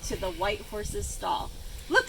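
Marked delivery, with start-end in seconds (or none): none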